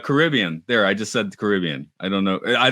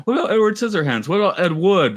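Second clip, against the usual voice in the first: mock protest; 10 semitones higher, faster than usual. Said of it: "I was going to say".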